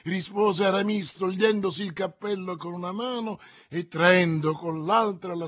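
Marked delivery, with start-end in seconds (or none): none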